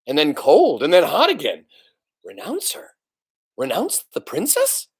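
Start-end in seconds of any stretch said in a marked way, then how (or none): none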